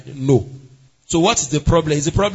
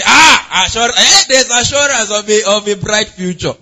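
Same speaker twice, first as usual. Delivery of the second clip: loud, higher-pitched mock protest; loud exclaiming; 4 semitones higher, 9 dB louder than usual. Believer insisting on assurance of a bright future, loud exclaiming. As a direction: burst out with hammed-up insistence. Acts out someone claiming assurance.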